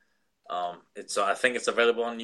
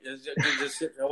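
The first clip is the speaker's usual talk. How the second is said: ordinary talk, nothing marked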